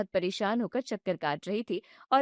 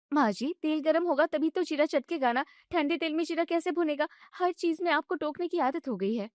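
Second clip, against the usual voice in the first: higher, indignant daughter-in-law voice; young wife protesting; 5 semitones higher than usual. Acted. Bride objects when mother-in-law shuts off the gas, indignant and high. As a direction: push back, hurt and annoyed. Bride argues about cooking.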